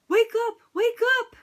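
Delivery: high, loud wake-up call; calling out loudly. A narrator's reading. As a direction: shout through the door to wake a friend. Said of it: "he called to his friend".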